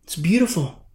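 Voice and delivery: usual speaking voice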